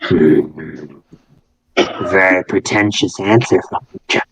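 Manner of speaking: low, haughty voice in the head; condescending. Acted. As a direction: look down on a child, pitched low. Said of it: "very condescendingly".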